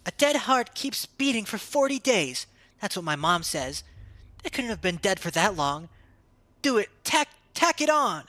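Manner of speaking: put-on high, eager kid's voice arguing; child character voice. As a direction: play a stubborn little kid explaining. Voicing children in the game.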